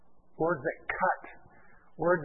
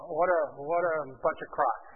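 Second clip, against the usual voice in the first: ordinary delivery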